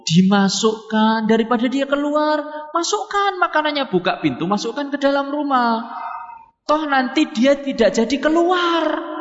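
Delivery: ordinary delivery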